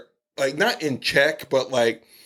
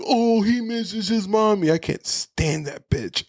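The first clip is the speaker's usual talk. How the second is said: imitating a jeering taunt, pitched up at first; mock him in a higher voice, then slow down; 8 semitones higher than usual. Character jeers at another missing his mom, mocking. Retold villain mocking someone's mommy.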